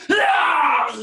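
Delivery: sudden high, loud shriek; mock honey badger shriek. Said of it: "the honey badger literally just turned and was like".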